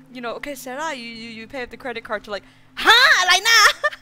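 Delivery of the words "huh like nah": very loud, high mock-mom yell; sudden outburst. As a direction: explode suddenly, imitating a mom. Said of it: "she just went from, like".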